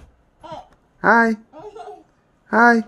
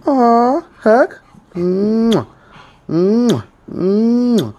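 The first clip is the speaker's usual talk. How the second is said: ordinary delivery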